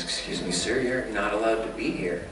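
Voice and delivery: low, soft, officious objection; nerdy Vulcan. Acted. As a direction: play a nerdy Vulcan quietly telling someone off. Quoting a nerdy Vulcan librarian.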